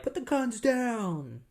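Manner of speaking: high, drawn-out mock police call; imitated police shout. Police arrive and order everyone to disarm, mock shout.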